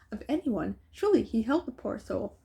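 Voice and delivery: ordinary delivery, nothing marked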